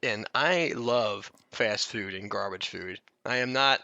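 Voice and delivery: ordinary talk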